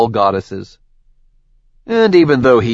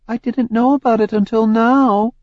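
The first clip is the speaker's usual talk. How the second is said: higher, accusing goddess voice; Hera's voice; 13 semitones higher, 3 dB louder than usual. Acted. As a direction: lay out his crimes coldly. Hera answers.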